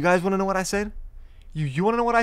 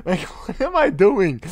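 laughing; 3 semitones higher than usual. Real, not acted. Laughing at his own line.

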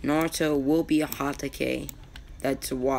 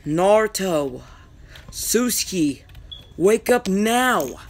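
loud, high wake-up call; yelling; 5 semitones higher, 6 dB louder, slower than usual. Acted. Kakashi rousing Naruto and his teammate from sleep, yelling. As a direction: call out sharply, stretching the last words. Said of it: "he yelled".

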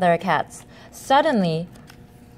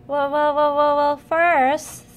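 slow halting repeated well, voice lifted; high, slow stammer; 5 semitones higher, slower than usual. Acted. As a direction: stammer it out like a nervous cat. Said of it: "stammered Keith".

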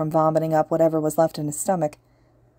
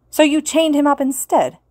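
high, loud accusing snap; snapped; 9 semitones higher, 5 dB louder than usual. Acted. Kirishima snapping at the teacher, high loud accusation. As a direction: throw it back at her. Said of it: "Kirishima snapped".

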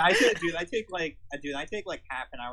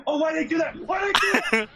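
mock shout; 13 semitones higher, 9 dB louder than usual. Mock outraged shouting bit.

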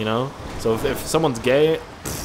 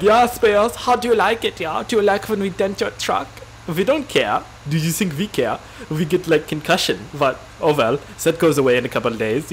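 mock German deer, taunting; German accent; 2 semitones higher than usual. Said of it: "I don't know why they're German".

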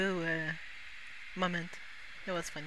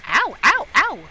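loud high ow's; mock pain; 7 semitones higher, 11 dB louder, slower than usual. Real, not acted. Gamer reacting to being hit, loud mock yelps. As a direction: yelp as if hit.

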